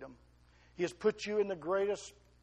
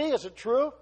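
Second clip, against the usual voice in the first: higher, louder rising question; loud rhetorical question; 8 semitones higher, 10 dB louder than usual. Acted. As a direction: push the question out to the room. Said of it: "asks the church".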